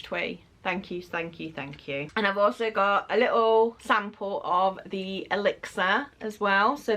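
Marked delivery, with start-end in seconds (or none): 0.0-2.1 softer, lower heartfelt thanks; tender gratitude. Real, not acted. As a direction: murmur your thanks sincerely. Soft thanks to sender.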